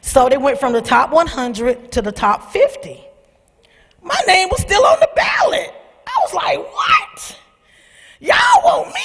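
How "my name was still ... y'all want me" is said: very high, laughing squeal of surprise; squealing disbelief. Retold or imitated. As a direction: squeak it out in happy shock.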